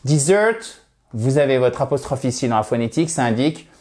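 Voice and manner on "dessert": "Dessert" with the second syllable SERT raised and pushed; stress on SERT. Shown as a demonstration. Teacher stressing SERT in "Dessert" against "désert". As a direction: say "Dessert" light on des, strong on SERT. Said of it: "l'accentuation sur la seconde syllabe".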